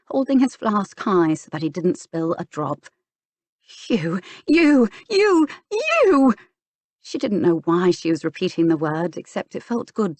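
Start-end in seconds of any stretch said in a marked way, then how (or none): 3.9-6.3 climbing higher and louder furious stammer; sputtering fury. A narrator's reading. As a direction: choke on rage, words failing.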